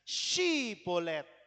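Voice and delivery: "Shibolet" sangat pelan dengan SH panjang; bunyi SH di awal. Shown as a demonstration. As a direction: tahan SH pada "Shibolet". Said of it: "mengucapkan kata Ibrani". ("Shibolet" lambat, berawalan desis SY)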